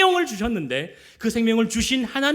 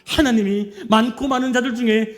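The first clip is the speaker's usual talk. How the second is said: louder, forceful blessing from the pulpit; fervent preaching; 3 semitones higher, 6 dB louder than usual. Acted. Pastor blessing the congregation, forceful preaching. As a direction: proclaim it with full conviction.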